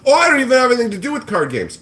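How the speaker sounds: high, loud, fast mock whine; mocking whiny flipper. Streamer parodies the flipper's excuse, fast high whine.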